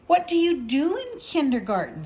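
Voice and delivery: put-on high, loud child's question; curious little girl. Little girl asking her new teacher, high and eager. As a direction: ask like an eager small child.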